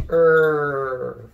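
Er as a loud, drawn-out er sound; R sound for raccoon, loud. Shown as a demonstration. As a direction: stretch out Er as the R sound. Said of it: "first sound that comes out of your mouth".

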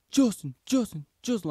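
high, loud repeated name-calling; imitating urgent calling. Dad trying to wake passed-out son, high loud calls. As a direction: call out to rouse someone unconscious. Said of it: "trying to, like, wake me up".

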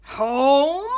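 loud, high, incredulous "Home?". Acted. Driver booming at Henry, loud and incredulous. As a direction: bellow it in disbelief. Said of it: "the driver said rather loudly".

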